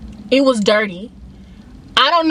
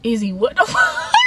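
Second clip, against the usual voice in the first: breaking into laughter. Retold or imitated. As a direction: question bursting into a laugh. Retells cracking up at Tony's slowness.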